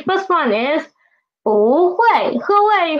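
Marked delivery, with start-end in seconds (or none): 1.4-2.4 "不会" with rising bú, then falling huì; changed tone sandhi. Shown as a demonstration. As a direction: lift "不" into second tone before falling "会". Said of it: "it will change to second tone".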